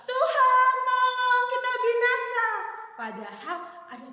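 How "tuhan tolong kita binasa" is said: high, sing-song wail for help; hammed-up cry of fear; mock panicked cry. Disciples crying to the Lord in the storm, high mock wail. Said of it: "Dia langsung tuh berteriak".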